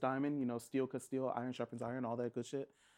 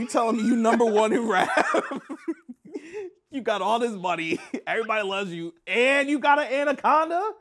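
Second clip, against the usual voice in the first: loud, higher, laughing outrage; incredulous rant; 8 semitones higher, 9 dB louder than usual. Real, not acted. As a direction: rant in disbelief, half laughing. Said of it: "[name] was pissed".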